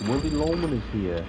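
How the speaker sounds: put-on high Brooklyn accent as Willy Loman. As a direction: announce yourself in a Brooklyn accent. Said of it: "the odd moment where I'm like".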